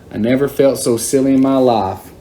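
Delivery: usual speaking voice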